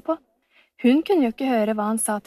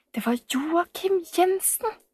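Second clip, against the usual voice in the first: higher, slow gasp of recognition; shocked realisation; 8 semitones higher, slower than usual. A narrator's reading. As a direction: let the name land with alarm. Frightened recognition of the villain.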